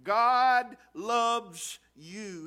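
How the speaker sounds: each word slow and weighty, "God" carrying the stress; slow emphatic statement. Real, not acted. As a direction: spell it out slowly for the congregation, leaning on "God". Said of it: "I want you to hear this".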